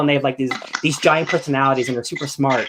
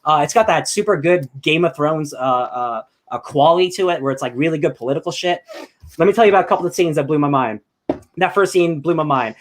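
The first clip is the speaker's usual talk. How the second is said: usual speaking voice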